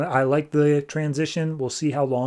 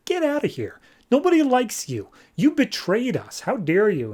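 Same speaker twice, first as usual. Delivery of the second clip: high-pitched, hammed-up indignation; mock outrage; 9 semitones higher than usual. Gods turn on Hermes for betraying them, mock outrage. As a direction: cast him out in a high, scolding voice.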